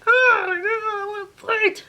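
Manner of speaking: high, drawn-out whiny howl; mock wail. Girl grabbed mid-fight by friends, mock wail. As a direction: wail it out in hammed-up frustration. Acted wail while held back.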